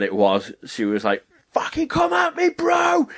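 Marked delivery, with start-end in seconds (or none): 1.5-3.1 very high-pitched mock battle scream; retold screaming. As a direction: scream it like taking on VR monsters. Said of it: "Just, like, screaming as things".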